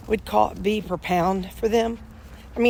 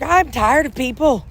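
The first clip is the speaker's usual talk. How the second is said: exasperated; 7 semitones higher, 6 dB louder than usual. Retold or imitated. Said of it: "I'm like".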